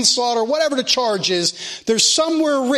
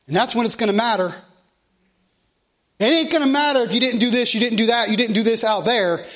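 usual speaking voice

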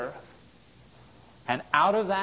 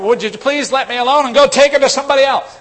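louder, higher, fast brush-off; imitating an annoyed listener; 10 semitones higher, 13 dB louder, faster than usual. Preacher imitates someone begging believers to leave him alone, fast and loud. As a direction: rattle off the plea to be left alone. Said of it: "I've heard people say that".

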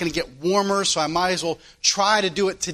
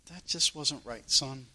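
hushed, much softer and lower gentle correction; still small voice; 6 semitones lower, 10 dB quieter than usual. Retold or imitated. The Lord gently correcting a grumbling son, hushed. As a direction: speak like a quiet nudge in the heart. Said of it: "in a still, small voice".

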